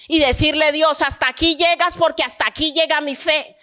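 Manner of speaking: loud, high, driving sermon climax; preaching at full force. Real, not acted. Pastor rising to full force before the congregation, preaching. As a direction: preach it out with fire to the church.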